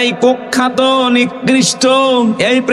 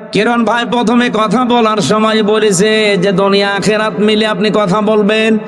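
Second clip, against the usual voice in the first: ordinary delivery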